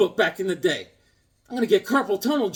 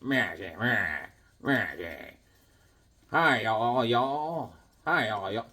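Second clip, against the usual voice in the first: low, slow talking-doll voice; comedian doll impression; 7 semitones lower, 5 dB quieter than usual. Imitating the talking comedian toy, low and slow. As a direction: drop low and drag it out like the toy. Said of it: "I forgot who the comedian is".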